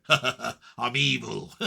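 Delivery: a lightly voiced devil from below; mock evil laugh. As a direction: cackle like a cartoon devil. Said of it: "Like that".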